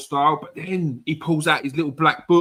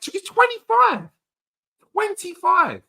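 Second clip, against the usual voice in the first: incredulous mock quote; 5 dB louder than usual. Mocking a claimed age.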